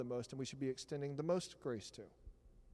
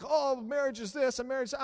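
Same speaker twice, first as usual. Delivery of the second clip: mocking newlyweds; 9 semitones higher, 7 dB louder than usual. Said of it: "they're like".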